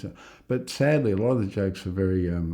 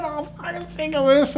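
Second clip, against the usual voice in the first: much higher, louder, hammed-up despair; mock distraught rant; 3 dB louder than usual. Fan after Melbourne loses, hammed-up outburst. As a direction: play the gutted footy fan losing it. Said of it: "he went nuts".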